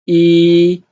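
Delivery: E held long on a high, level pitch; sing-steady, loud E with no fall. Shown as a demonstration. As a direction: stretch E out high and even. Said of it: "the first tone".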